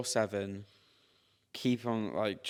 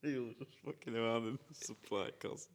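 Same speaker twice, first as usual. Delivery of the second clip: laughing through the words; amused, giggling repeat; 7 dB quieter, faster than usual. Real, not acted. Repeating friend's joke, amused.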